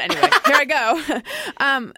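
laughing while saying it. Real, not acted. Laughing to the host as she asks. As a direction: giggle through the words. Laughing through nervous question setup.

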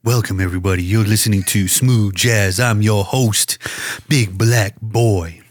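low, slow smooth-jazz host. Pretend. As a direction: draw it out like a late-night radio host. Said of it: "I'll do it in smooth voice".

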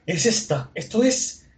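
high gasp, then amazed recognition; hammed-up gasp of discovery; awestruck. Vendor unsheathing a legendary sword, astonished. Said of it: "pone una cara increíble".

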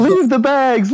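high, loud shouted order; mock shout. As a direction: bark it like a panicked order. Urging him to leave bags.

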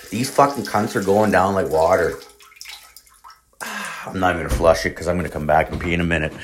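ordinary talk, nothing marked

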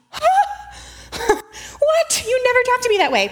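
high, hammed-up wounded gasp and protest; mock a child's offended shock, very high. Imitating a child yelled at by parent, shocked and hurt.

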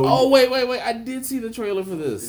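high, loud sudden recall; surprised realization. Real, not acted. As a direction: it suddenly clicks for you. Sudden recognition of the trailer.

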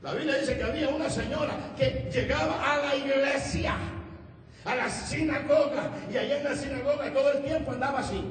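high, emphatic sermon delivery; preaching. Acted. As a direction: proclaim the Bible story to the congregation.

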